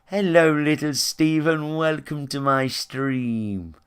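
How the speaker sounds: slow sing-song greeting, sweetly put on; mocking sugary streamer. Streamer mocked for charming kids: slow sugary greeting. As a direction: parody a twee streamer charming kids, drawn out. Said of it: "poncy motherfuckers ... whistle charm little kids".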